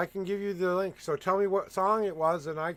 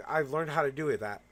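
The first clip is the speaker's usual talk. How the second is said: ordinary talk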